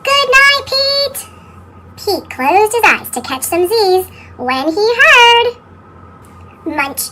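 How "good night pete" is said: high goodnight. Acted. Sleepy goodnight to Pete.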